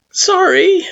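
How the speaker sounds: meek "Sorry." with a rising tune; timid apology, unsure; small sorry. Acted. Frisk apologizing.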